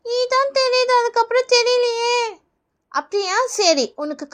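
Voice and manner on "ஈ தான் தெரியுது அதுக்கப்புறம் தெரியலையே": put-on high, puzzled kid voice; child pupil. Pupil failing the eye test, puzzled child voice. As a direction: admit it like a small pupil.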